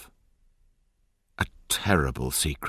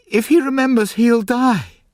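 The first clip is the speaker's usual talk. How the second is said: high, loud desperate pleading, much higher; distressed crying out; 8 dB louder than usual. A narrator's reading. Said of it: "she cried".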